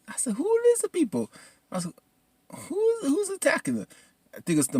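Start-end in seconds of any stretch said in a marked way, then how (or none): 0.3-3.9 high falsetto questioning; bewildered viewer reaction. Retold or imitated. Viewer asking who the attackers are, high bewildered squeak.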